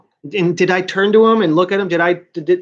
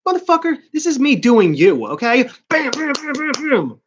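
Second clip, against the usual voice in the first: mock fury; 8 semitones higher than usual. Acting out punching classmate.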